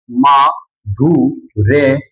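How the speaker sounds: माधुर्य stretched very slowly, each syllable held long; drawn out. Shown as a demonstration. Teacher repeating the answer for students, slowly drawn out. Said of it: "क्या बन जाएगा?".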